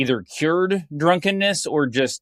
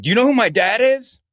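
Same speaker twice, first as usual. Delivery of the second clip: high, entitled demand; mock the spoiled son; arrogant quote; 9 semitones higher, 4 dB louder than usual. Mocking a wrestler's entitled boast, raised and loud.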